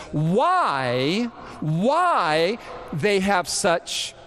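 "why" stretched slowly and said twice; slow, weighty stress on both "why"s; emphatic WHY. Retold or imitated. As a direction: draw out each "why" with heavy emphasis. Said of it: "he says with emphasis".

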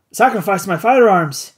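ordinary delivery

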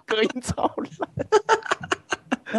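very slow, laughing through the words. Real, not acted. Laughing while complaining about the terrible soundproofing.

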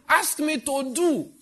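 high, loud, slow insistent preaching; full-force preaching. Real, not acted. Pastor voicing God to the church in a loud, rising, insistent cry. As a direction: hammer each repeat harder.

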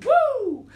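loud, high-pitched cheer; high whoop. Real, not acted. Storyteller cheering along with the children, high whoop.

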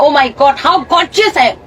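drawn-out, very slow and vain; slow mock vanity; slow self-admiring. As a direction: savour every word like posing at a mirror. Wife admiring herself in dress.

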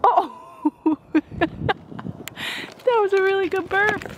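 high, delighted cooing praise. Real, not acted. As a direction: coo delightedly with a laugh. Praising a baby's burp.

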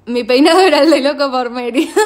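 talking through laughter; can barely get it out for laughing. Real, not acted. Funny story about her hair. (riéndose mientras habla)

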